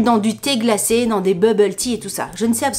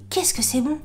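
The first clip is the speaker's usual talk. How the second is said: softly savouring the taste; hushed delight; 4 semitones higher, 6 dB quieter than usual. Retold or imitated. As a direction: marvel at it under your voice. Raves about taste, first take.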